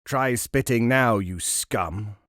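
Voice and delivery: ordinary delivery, nothing marked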